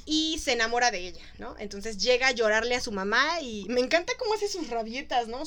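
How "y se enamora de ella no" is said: ordinary delivery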